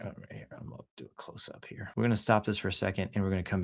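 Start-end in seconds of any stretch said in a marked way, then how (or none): 0.0-1.9 quick hushed aside to the camera; whispered aside. Real, not acted. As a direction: whisper it off-mic, fast.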